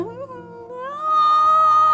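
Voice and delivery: high shrieking burst of laughter. Real, not acted. Bride recalling the earring pain, shrieking laugh.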